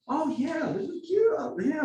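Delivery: put-on high cooing reply to a visitor's accent; charmed local. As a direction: coo it like you find the stranger adorable.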